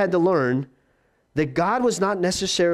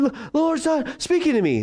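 whiny panicked believer; 9 semitones higher than usual. Acted.

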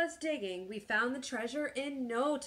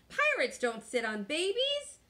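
higher-pitched baffled pirate captain; indignant pirate; 6 semitones higher, 3 dB louder than usual. A narrator's reading. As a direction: protest in puzzled disbelief.

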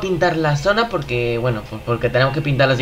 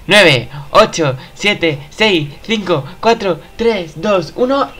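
countdown; 5 semitones higher, much slower than usual. Real, not acted. Counting down the final seconds.

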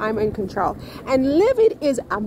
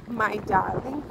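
ordinary talk, nothing marked

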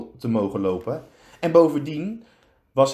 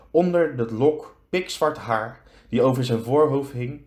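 plain reading voice

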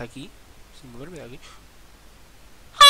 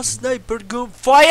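slow, drawn-out meme announcer, higher and louder; mock announcer call; 8 semitones higher, 14 dB louder, much slower than usual. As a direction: call it out like a hyped game trailer. Meme sound-effect call. (voz de anunciador meme, lenta y fuerte)

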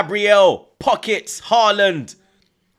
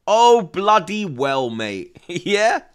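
usual speaking voice

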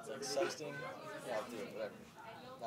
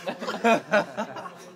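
loud laughing fit; cracking up; burst of laughter; 7 semitones higher, 10 dB louder than usual. Real, not acted. Cracking up at the texts.

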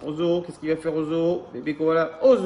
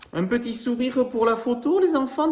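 much higher, sing-song coaxing; mother voice; 9 semitones higher, 4 dB louder than usual. Acted. Mum asks the children to smile for the photo, in a high sing-song voice. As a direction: coax a smile for the picture. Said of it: "dit maman".

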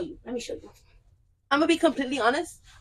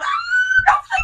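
high squeal; 9 dB louder, much slower than usual. Pretend. Playful shriek after church-wife bit.

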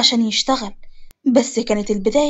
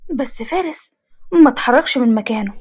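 plain reading voice

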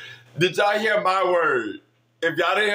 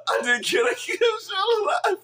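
higher, laughing through tears; real tearful laughter; crying with laughter. Real, not acted. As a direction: gasp it out mid cry-laugh. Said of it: "Bitch, I am crying".

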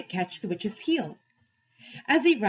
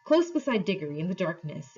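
ordinary delivery, nothing marked

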